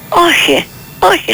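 startled denial, pitched high; surprised denial. Retold or imitated. Mother answering her sister-in-law, startled and high.

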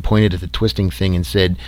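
usual speaking voice

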